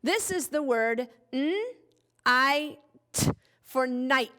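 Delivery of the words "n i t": "n-I-T" slowly, sound by sound. Shown as a demonstration. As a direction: stretch "n-I-T" into separate n, long I and t sounds. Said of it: "I G H says I as in I".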